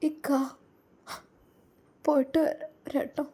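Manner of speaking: fading, tear-choked goodbye to her husband; choked farewell. Acted. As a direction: voice breaking as the words fail. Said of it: "അവൾക്ക് സംസാരിക്കാൻ കഴിയുന്നില്ലായിരുന്നു".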